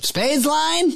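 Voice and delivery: imitating the director asking for a missed line; impatient director. Director prompting the frozen actor, high mock impression. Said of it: "Pete goes".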